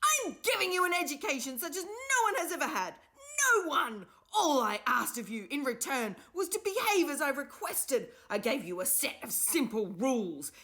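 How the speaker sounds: high, loud, cracking furious scream. A narrator's reading. The enraged professor screams at the class, furious. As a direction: lose control and scream it out. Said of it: "He screamed at them".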